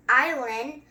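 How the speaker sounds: usual speaking voice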